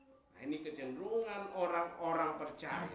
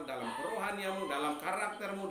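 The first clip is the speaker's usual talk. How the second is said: ordinary delivery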